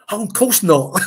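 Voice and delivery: loud, high mock-delighted dismissal; starstruck reassurance. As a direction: imitate a thrilled fan waving it away. Imitating the starstruck officer waving off ticket.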